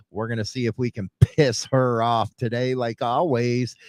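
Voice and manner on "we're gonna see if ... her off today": ordinary talk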